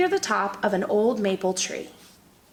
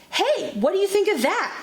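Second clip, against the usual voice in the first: exclaimed; 9 semitones higher than usual. Acted. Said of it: "he exclaimed".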